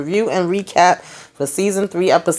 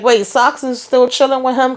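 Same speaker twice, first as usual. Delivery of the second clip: surprised reaction; 6 semitones higher than usual. Retold or imitated. Acts out own surprised reaction.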